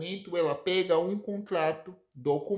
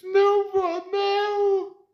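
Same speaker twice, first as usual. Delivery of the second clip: crying; 14 semitones higher, 6 dB louder than usual. Acted. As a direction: wail it out, refusing the loss. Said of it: "Chorando, Sandra diz". (neta gritando chorando à avó que morre)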